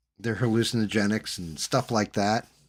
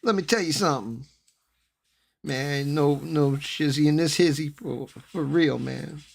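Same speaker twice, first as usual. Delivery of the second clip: high, cocky wannabe voice; posturing wannabe tough guy; wannabe gangster; 6 semitones higher than usual. Retold or imitated. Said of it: "you know the guy".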